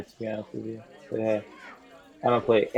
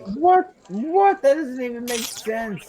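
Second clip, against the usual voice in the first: high, incredulous "what"; disbelieving shout of surprise; surprised; 11 semitones higher, slower than usual. Real, not acted. Host says the episode is ending.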